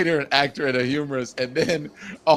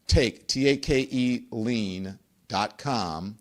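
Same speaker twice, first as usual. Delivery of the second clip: slow spelled-out web address; 3 semitones lower, slower than usual. Shown as a demonstration. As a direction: spell it out very slowly, letter by letter.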